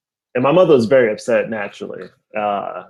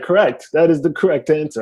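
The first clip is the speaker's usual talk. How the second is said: put-on mom's thick Haitian accent; 3 semitones higher than usual. Mom approving her son's answer, Haitian accent. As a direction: pronounce the verdict in a Haitian accent. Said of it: "my mom has a very thick accent".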